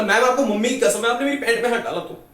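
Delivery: loud, high, urgent warning; frantic protest. Retold or imitated. Pupil stopping teacher searching his torn pocket, frantic protest. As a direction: stop them with a panicked oath.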